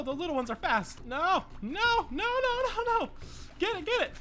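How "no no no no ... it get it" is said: high-pitched desperate cries; frantic high pleading. Real, not acted. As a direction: beg the arrow to land. Frantic boss fight.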